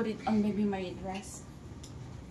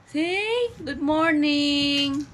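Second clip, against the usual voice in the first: higher and very slow singsong; 4 semitones higher, 4 dB louder, much slower than usual. Real, not acted. Mother coaxes her baby to greet viewers, slow and singsong.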